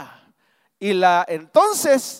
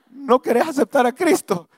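father's plea; 7 semitones higher, 4 dB louder than usual. Retold or imitated. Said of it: "mi papá le dijo".

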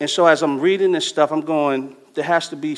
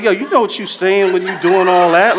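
mock challenge; 4 semitones higher, 7 dB louder than usual. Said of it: "But it's like".

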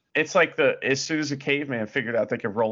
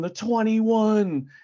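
imitating the commentators; 9 semitones higher, 4 dB louder, much slower than usual. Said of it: "they kept saying".

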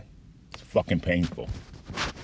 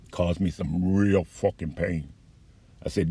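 ordinary talk, nothing marked

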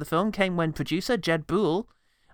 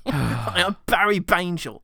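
Barry Bangel voice; 3 dB louder, much slower than usual. Pretend. Character name joke, Barry Bangel.

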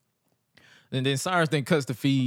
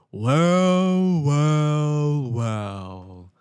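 pompous introduction; 4 dB louder, much slower than usual. Retold or imitated. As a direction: savor each word like a showboat. Imitating wrestler's grand introduction.

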